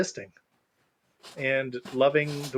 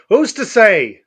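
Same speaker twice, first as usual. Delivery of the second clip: Uncle Fester voice; 5 semitones higher, 10 dB louder, slower than usual. Retold or imitated. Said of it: "In the words of Uncle Fester".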